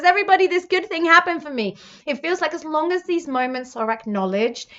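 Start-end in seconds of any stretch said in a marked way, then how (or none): 0.0-1.8 bright, fast and higher, louder; quick gleeful announcement to everyone; excited announcement. Pretend. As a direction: blurt out the good news happily. Text announcing good news.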